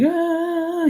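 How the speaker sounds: very high imitated dog whine; imitated excited dog whine; dog whine imitation. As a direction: squeak like a happy dog. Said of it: "he'll be making all this noise".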